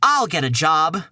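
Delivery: ordinary talk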